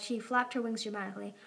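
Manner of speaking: plain reading voice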